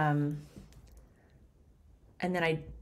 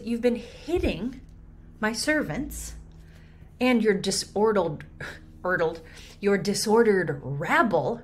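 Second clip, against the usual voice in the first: rising, louder, stumbling over disordered; riled up; 4 semitones higher, 5 dB louder than usual. Acted. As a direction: spit it out at the rabble, riled. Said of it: "She's riled up".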